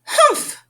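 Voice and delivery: a short, high, scornful humph; huffy humph. Acted. The narrator voicing a kangaroo's scornful humph. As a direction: sniff it off like a snooty kangaroo. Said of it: "humped a voice".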